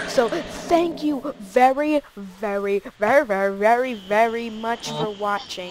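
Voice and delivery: slow stretched-out thanks; drawn out. Acted. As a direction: drag every very out slowly.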